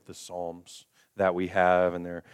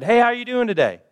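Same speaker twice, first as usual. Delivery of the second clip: much higher and louder, bright hello; cheery greeter; 10 dB louder than usual. Retold or imitated. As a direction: greet like an eager extrovert at the door. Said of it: "and they said".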